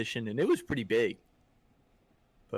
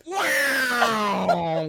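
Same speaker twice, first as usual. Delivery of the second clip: fish noise; 10 semitones higher, 6 dB louder than usual. Retold or imitated. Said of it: "the fish goes".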